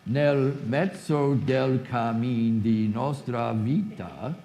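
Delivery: slow, syllable-by-syllable Italian line; Italian recitation. Shown as a demonstration. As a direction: count out each syllable in Italian. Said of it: "let's test it".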